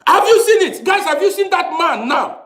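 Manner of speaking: outraged rant. Real, not acted. Escalating rant at viewers.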